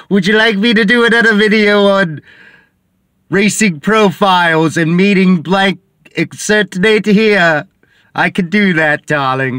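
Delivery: high, loud, hammed-up fake accent; [name]'s bad accent. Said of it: "Sorry, my accent sucks".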